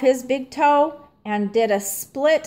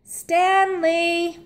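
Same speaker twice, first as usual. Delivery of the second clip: higher calling yell; yelled name; 8 semitones higher than usual. A narrator's reading. Arthur yells to Stanley on the ice, a high call. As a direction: shout his name across the ice. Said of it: "yelled Martin".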